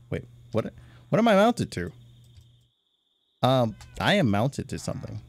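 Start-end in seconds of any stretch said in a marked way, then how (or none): 1.1-1.9 high, loud startled question; startled, can't get off; alarmed. Real, not acted. Gamer finds himself stuck on something, startled.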